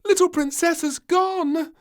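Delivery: very high, loud alarm; panicked. Acted. Maid telling the house the princess is gone, panicking. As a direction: shriek the news in panic.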